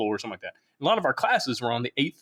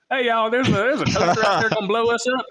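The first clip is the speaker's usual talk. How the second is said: put-on Alabama accent; 8 semitones higher, 4 dB louder than usual. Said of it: "in that accent".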